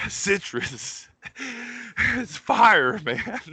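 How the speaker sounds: reaching the supervisor after sprinting, breathless and alarmed; out of breath. Retold or imitated. Gasping, breathless alarm. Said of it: "Like, catching your breath".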